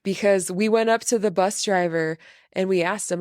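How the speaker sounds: ordinary delivery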